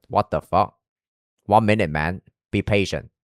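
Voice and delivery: usual speaking voice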